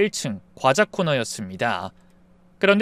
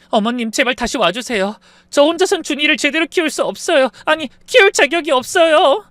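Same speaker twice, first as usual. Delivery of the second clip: high, louder begging through tears; tearful pleading; 10 semitones higher, 5 dB louder than usual. Acted. Kneeling daughter-in-law begs mother-in-law, high tearful plea. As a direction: beg her to come back, sobbing. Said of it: "흐느끼더니 제게 무릎을 꿇고 간청을".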